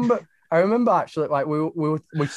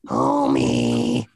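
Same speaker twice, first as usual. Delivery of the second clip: much lower croaky cartoon mom voice; Marge Simpson; 3 dB louder than usual. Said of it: "doing a Marge Simpson impression".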